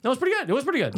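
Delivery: high mock comic voice; impression voice. Shown as a demonstration. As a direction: say it like a stand-up impression.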